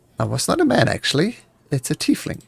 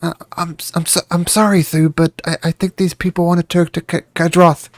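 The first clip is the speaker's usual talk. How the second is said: higher, nervous, stammering little voice; a mild hint of a frightened girl; meek servant girl stammering to her master; timid servant; 5 semitones higher than usual. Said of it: "the quiet voice of the tiefling".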